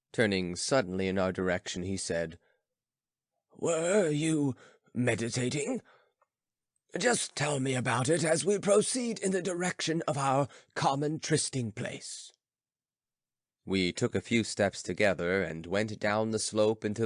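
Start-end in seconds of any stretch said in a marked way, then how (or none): 3.5-12.3 lightly voiced philosopher, higher and musing; old philosopher. Philosopher musing to his friend, lightly voiced. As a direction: ponder aloud, slowing on stone still.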